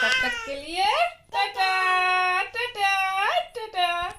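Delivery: high, loud, drawn-out sign-off; calling goodbye. Real, not acted. (ऊँची, खिंची आवाज़ में विदा लेते हुए)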